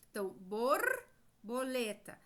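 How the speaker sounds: borboleta drawn out slowly, the or in bor held; slow word demo. Shown as a demonstration. Teacher to children, slow borboleta to show the or. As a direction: stretch borboleta so the or stands out. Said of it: "nós temos o or".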